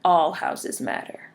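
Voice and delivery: low, slow, quiet deadpan; acted dismissive reply. Firefighter answering a homeowner whose house burns, dismissive deadpan. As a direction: drop right down and say it slowly, unbothered.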